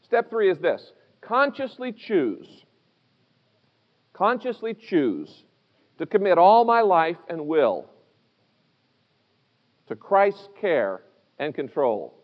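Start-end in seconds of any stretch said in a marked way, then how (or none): 1.2-12.1 slow, measured reading for note-takers; reading out slowly. Real, not acted. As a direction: read it out slowly so they can fill it in. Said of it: "let me read it to you, and if you'll fill this in".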